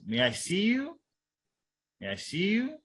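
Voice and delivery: YOU gliding high up at the end; polite rising request. Shown as a demonstration. Lecturer demonstrating a polite request, rising intonation. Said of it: "Simple polite request".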